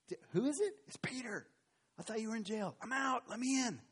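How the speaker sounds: put-on high, eager girl's voice; excited girl. Rhoda talking to Peter through the gate, high and eager.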